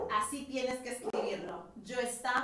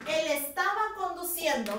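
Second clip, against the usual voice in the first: usual speaking voice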